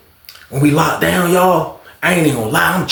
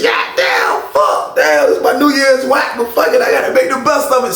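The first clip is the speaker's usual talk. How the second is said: mock frustration; 10 semitones higher than usual. His own voice, retold.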